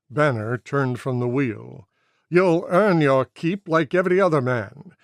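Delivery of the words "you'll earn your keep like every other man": loud, raised command in a German-free captain's voice; captain's order. A narrator's reading. Captain ordering Cassidy out to fight. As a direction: push the new man out firmly.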